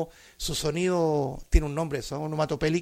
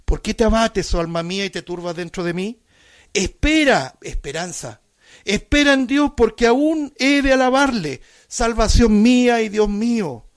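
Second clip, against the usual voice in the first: high, loud fervent proclamation; preaching at full force; 4 semitones higher, 7 dB louder than usual. Acted. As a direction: lift the verses up with full power.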